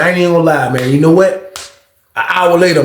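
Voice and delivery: plain reading voice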